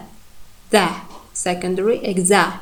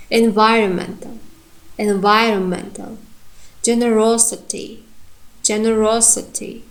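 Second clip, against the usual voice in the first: each word twice, primary stress clearly hit; reading with correct stress. Shown as a demonstration. Teacher reads the word list, clear and careful. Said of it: "try to read them with correct stress".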